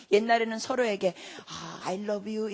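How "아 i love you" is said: drawn-out, unhurried I love you; retold endearment. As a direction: say it very slowly, as a quote. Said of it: "이런 말 안 했어요". (천천히 늘인 사랑 고백 재연)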